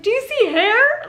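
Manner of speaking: high, loud eager question; excited. Retold or imitated. Mother asking the doctor about hair, excited. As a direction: ask it bursting with excitement.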